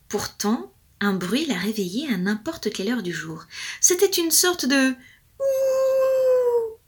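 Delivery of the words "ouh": long high howling ouh; wolf howl. A narrator's reading. Storyteller howling like the distant wolf.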